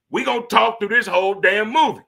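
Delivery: loud promise of a rowdy theater; loud hype. Real, not acted. Hyping up the movie crowd.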